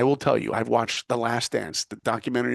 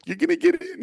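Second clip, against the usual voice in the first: mom's voice. Retold or imitated. Mom encouraging on phone.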